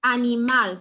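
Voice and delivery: loud "Animal" with final MAL punched; stress on MAL. Shown as a demonstration. Teacher drilling the word, "Animal" stressed on MAL. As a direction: model a-ni-MAL, hitting the last syllable. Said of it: "on the syllable that is stressed".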